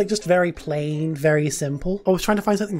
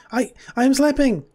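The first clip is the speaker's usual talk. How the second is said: much higher, indignant cry; high protest; 9 semitones higher, 4 dB louder than usual. Acted. Sleeping character insisting it's asleep, high. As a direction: yelp it up high.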